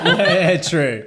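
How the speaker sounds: loud laughing agreement. Real, not acted. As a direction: laugh out the words. Teasing back through laughter.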